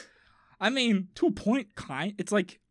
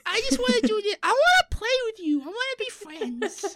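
put-on very high, childlike dog voice; Bowie. Shown as a demonstration. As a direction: beg like an eager pet wanting a playmate. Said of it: "Bowie's like".

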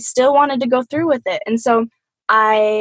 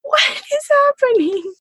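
high, much higher, startled cry of disbelief; bewildered mom. Retold or imitated. Mom walking in on the sobbing pair, a shocked high cry. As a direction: squeak it out in shocked confusion. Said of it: "She's just like".

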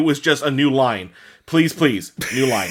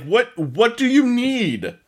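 high, slow, exasperated question; slow exasperated ask at the poster; 9 semitones higher, slower than usual. Pretend. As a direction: drag it out, fed up.

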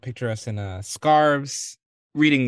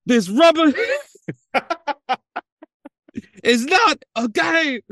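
laughing; 13 semitones higher, 5 dB louder than usual. Acted. Joking pun, laughing through.

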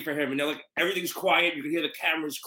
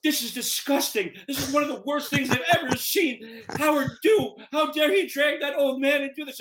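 higher-pitched indignant woman's voice; outraged reporter; 9 semitones higher than usual. Retold or imitated. Penny Crone scolding the nephew about Howard, high indignant voice. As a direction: scold like a shocked veteran reporter. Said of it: "She goes".

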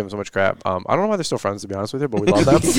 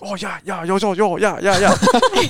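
high-pitched imitation of Japanese speech; mock Japanese accent; 7 semitones higher, slower than usual. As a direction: mimic mom faking a Japanese accent. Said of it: "Japanese people".

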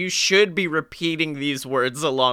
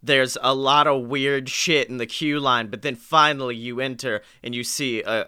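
normal talking voice

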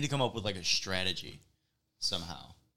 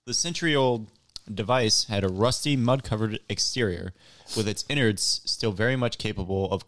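louder, projected reading voice; louder news-story reading; news article read-aloud; 7 dB louder than usual. Real, not acted. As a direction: read the article out clearly to the room.

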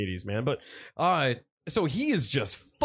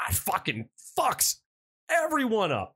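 usual speaking voice

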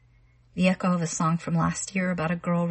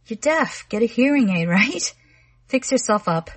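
mocking; 4 semitones higher, 4 dB louder than usual. A narrator's reading. Arnold mocking Ellie.

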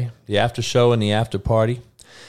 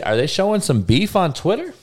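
normal talking voice

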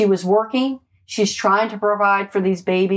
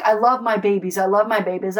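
ordinary talk

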